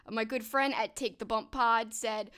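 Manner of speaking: normal talking voice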